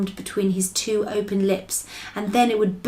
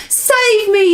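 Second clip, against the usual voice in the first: high, loud terrified scream; 12 semitones higher, 8 dB louder than usual. A narrator's reading. Giant crying for help in a nightmare, screamed. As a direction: scream out for rescue. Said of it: "screamed the flesh lump eater".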